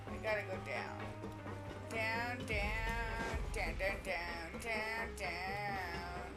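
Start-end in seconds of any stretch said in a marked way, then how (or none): none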